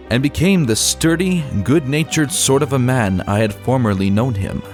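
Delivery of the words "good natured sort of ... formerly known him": ordinary delivery, nothing marked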